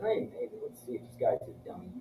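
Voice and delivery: low conspiratorial whisper; sneaky whisper. Retold or imitated. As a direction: murmur it like plotting a prank. Retelling sneaky plan to open van.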